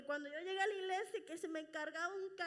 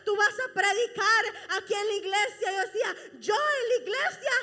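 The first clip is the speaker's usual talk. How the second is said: louder fervent sermon voice, laughing and choked up; full-force preaching; 4 semitones higher, 12 dB louder than usual. Acted. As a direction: preach it hard, voice breaking with emotion. (predicando con toda la fuerza, entre risas y llanto)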